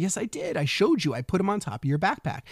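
insistent protest. Retold or imitated. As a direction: protest that you already did it. Retold argument, insisting to kids.

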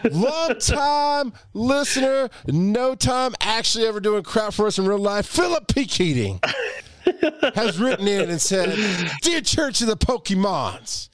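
high, booming mock preacher. Podcast host mock-preaching to his co-host in a preacher voice. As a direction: preach it like a hammed-up pastor from the pulpit. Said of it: "This is my preacher voice".

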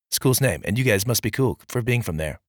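normal talking voice

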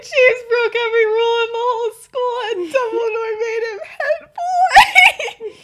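high, loud, laughing outburst; cracking up. Acted. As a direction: barely get the line out for laughing. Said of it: "he's just cracking up".

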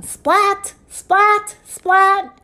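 high, loud, sharp splats; exclaimed high-pitched splats; lightning splats. Acted. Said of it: "He makes lightning".

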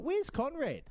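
mock asking where Conrad is, pitched high. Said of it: "I was like".

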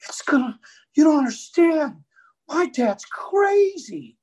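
high, pleading daughter telling the police; panicked daughter. Retold or imitated. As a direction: beg the cop, scared of dad. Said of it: "she's telling the police".

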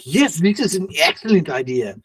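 put-on French accent slipping into German. Director welcoming an actor's hair suggestion, German-tinged accent. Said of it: "getting a little German in your accent".

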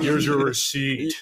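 slow, lightly imitated deep worker's voice; deep-voiced drive-thru worker. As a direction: draw it out like the deep-voiced cashier. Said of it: "this guy with a really deep voice".

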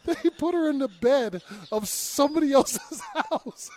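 high voice breaking into laughter; incredulous laughter. Real, not acted. Host tells co-host about wrong bed, laughing in disbelief. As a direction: tell it while cracking up. Laughs telling the absurd mistake.

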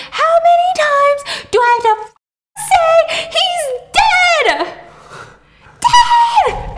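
very high, loud acted scream. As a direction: scream it out, losing all control. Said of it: "She's screaming".

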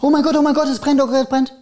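high, hammed-up panicked cry; imitating people freaking out about a fire; mock panic. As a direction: play a panicking bystander, pitch shooting up. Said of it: "die Leute rasten dann aus".

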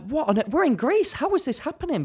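surprised. Retold or imitated. Retold shock on holiday.

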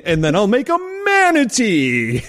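grand showman announcing a trick; theatrical magician. Retold or imitated. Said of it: "saying it like a magician".

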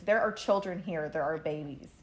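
ordinary talk

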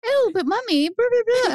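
mock the kid's excuse, pitched high; whiny kid. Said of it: "They'd be like".

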